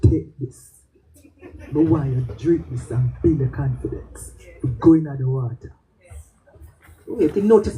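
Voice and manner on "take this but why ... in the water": juice man. Retold or imitated. Juice man Stammer urging her.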